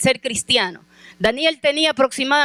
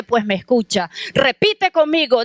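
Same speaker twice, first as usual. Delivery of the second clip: usual speaking voice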